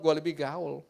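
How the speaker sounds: usual speaking voice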